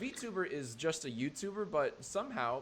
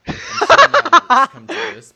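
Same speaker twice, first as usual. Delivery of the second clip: hammed-up furious yell, much louder and higher, laughing through it; mock outrage at the top of the lungs; 22 dB louder than usual. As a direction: bellow it out in fake rage while cracking up. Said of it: "I'm also furious".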